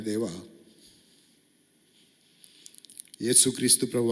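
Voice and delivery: earnest pastor praying aloud; a preacher praying to God the Father; fervent prayer. Acted. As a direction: lead the congregation in heartfelt prayer. Closing prayer led by preacher.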